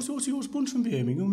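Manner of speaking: high-pitched Birmingham accent; Brummie accent. Shown as a demonstration. Interviewer pitches a droid accent, high Brummie voice. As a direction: put on a matey Brummie voice. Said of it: "But Brummie is".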